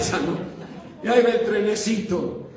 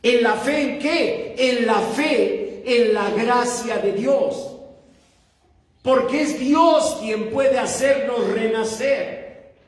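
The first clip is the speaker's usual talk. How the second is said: slow, higher-pitched pulpit proclamation; emphatic preaching; 6 semitones higher, slower than usual. Real, not acted. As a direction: drive each phrase home to the congregation. Preaches the key point emphatically.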